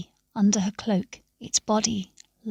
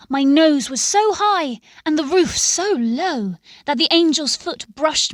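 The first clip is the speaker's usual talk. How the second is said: louder, higher rush of joy; excited; 6 semitones higher, 4 dB louder than usual. A narrator's reading. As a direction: swept up in the moment. Joyful braying climax.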